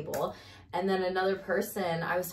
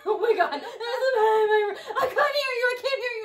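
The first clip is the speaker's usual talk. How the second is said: panicked crying; 6 dB louder than usual. Retold or imitated. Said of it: "like, crying".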